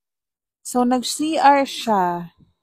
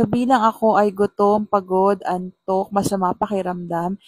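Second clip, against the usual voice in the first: ordinary talk, nothing marked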